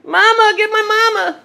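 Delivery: put-on very high, loud little-child call; voicing the baby. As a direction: squeal it like a toddler calling mom.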